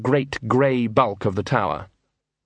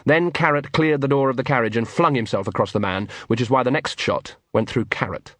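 usual speaking voice

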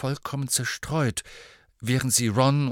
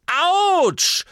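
very high, loud yelp of pain; pricked boy yelling ouch; 8 dB louder than usual. A narrator's reading. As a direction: shriek it. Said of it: "brüllte Malfoy".

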